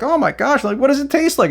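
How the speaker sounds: high-pitched mock kid voice; put-on high, gushing youngster; excited kid. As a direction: imitate an eager kid at a sleepover. Voicing sleepover kids around blindfold game.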